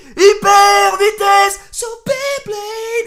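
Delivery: high, loud, slow chanted battle cry; sung-out hyper speed cry; shouted. Retold or imitated. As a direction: belt it out like a superhero. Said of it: "je crie".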